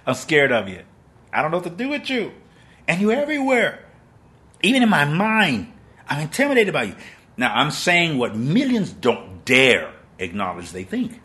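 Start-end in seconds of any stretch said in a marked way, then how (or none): 0.1-6.9 much higher, louder frantic plea; panicked pleading. Acted. A terrified believer crying out to an all-seeing God, frantic. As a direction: cry out in fear to God.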